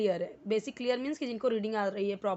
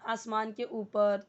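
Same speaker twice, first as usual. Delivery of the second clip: normal talking voice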